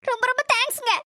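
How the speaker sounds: thanks still choked with tears; tearful thanks. Acted. Thanking the man who returned her bag, tearfully. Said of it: "இப்பவும் மாமா அழுதுட்டு இருக்கணும்".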